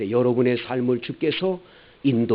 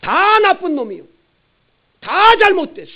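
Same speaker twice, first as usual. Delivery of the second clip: very high, loud sneering judge; mock self-righteous shout; self-righteous Pharisee; 11 dB louder than usual. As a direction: condemn everyone from a high horse. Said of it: "이런 사람은 자기는 의인체예요".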